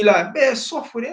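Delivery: ordinary delivery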